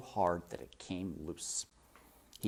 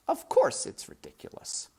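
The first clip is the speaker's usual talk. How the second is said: exclaimed aside. A narrator's reading.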